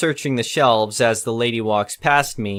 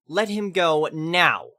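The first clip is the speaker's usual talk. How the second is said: slow stern demand; 6 semitones higher, slower than usual. Retold or imitated. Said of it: "flew into a rage".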